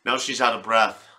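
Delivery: ordinary delivery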